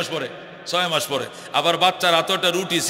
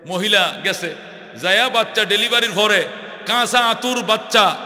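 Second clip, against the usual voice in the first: ordinary talk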